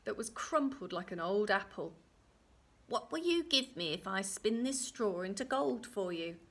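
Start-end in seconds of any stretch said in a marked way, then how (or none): 2.9-6.4 croaky voice. Acted. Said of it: "a strange, croaky voice".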